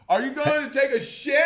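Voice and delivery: high, hollered teasing question; embarrassing party shout. Retold or imitated. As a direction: shout it across the room to embarrass her. Retold yelling across a party.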